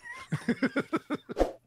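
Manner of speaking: high-pitched squeaky laughter; rapid high tittering; high giggle. Real, not acted. Laughs after being teased.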